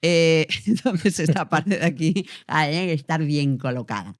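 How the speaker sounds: badly placed voice. Shown as a demonstration. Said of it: "como te hablo así".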